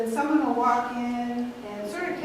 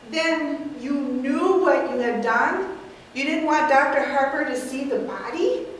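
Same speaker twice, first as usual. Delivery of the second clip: very slow, louder, spelled out; incredulous; 4 dB louder than usual. Acted. Mortimer questions the aunts, very slow. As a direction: drag it out in disbelief. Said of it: "playing the nephew, Mortimer".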